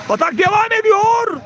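excited play-by-play. Real, not acted. Commentator as the attack continues, excited call. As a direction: lift the call high as the attack builds. Attack builds during live match.

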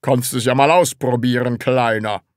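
loud, patronising dare; mocking. A narrator's reading. Department head dares the Sams to bite, mocking. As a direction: taunt the little one in a boss voice. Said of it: "sagte der Abteilungsleiter spöttisch".

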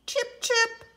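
high, chirpy chip; high bird chirp; sparrow chirp. Acted. Sparrow call in story.

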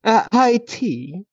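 hammed-up English manservant; mock English butler. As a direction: play the fawning servant offering tea. Mock butler serving high tea.

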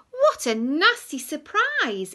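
high loud exclamation; loud cry. Acted. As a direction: shout the surprise. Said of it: "in his loudest voice".

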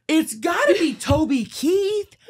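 slow high excited insistence; slow, high excited realization; eager realization. Retold or imitated. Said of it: "I go".

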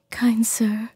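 a captive selkie begging for her skin; tearful selkie woman. Acted. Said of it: "choking back tears".

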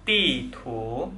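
"Ti" falling, "tu" rising; fourth then second tone. Shown as a demonstration. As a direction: say "Ti" with a sharp fall, "tu" sliding up. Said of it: "nada empat dan nada dua".

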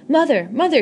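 high, loud call toward the teepee. Acted. Boy calls out for his mother in excitement. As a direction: shout for mother, rising. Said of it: "he called out".

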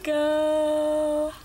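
loud call of Nico; mother calls Nico loudly on request. Shown as a demonstration. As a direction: call the name out loud to the baby. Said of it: "now turn around and do Nico".

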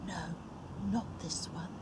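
hushed, low voice; inner voice of God. A narrator's reading. God speaking inside Samuel, hushed and low. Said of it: "God said inside him".

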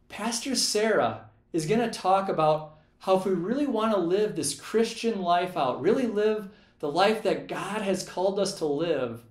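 ordinary talk, nothing marked

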